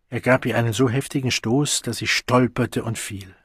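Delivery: ordinary delivery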